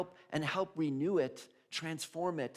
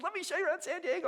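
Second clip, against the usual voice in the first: high, fast and eager; excited teen girl; much faster than usual. Retold or imitated. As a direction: rush it out with excitement. Excited girl offers tour.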